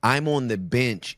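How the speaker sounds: ordinary talk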